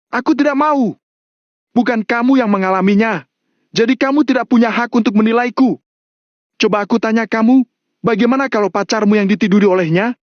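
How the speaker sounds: high, defiant outburst; high-pitched furious challenge; angry shout. A narrator's reading. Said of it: "Mario berteriak".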